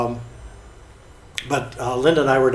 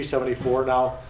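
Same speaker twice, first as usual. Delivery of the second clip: ordinary talk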